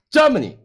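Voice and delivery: "Germany" with a high GER, then falling; stress on first syllable. Shown as a demonstration. Host confirming the stress for co-host, high GER in "Germany". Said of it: "终于在第一个".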